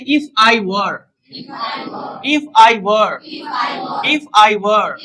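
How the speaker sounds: teacher calling out the drill phrase; slow drilled model phrase. Shown as a demonstration. Teacher drilling students, a slow drill model. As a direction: say it slowly for the class to repeat. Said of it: "सबसे पहले आप कहें".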